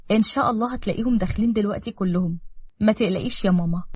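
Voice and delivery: ordinary delivery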